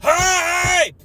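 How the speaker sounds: high, hoarse hype yells; hoarse sleep deprived hype. Real, not acted. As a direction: cheer it out like a worn-out streamer. Hype train level two.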